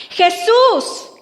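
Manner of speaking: high, loud cry for help; insistent, pleading yell; shouting. Acted. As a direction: call out desperately as the blind beggar. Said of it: "Y entonces comenzó a gritar".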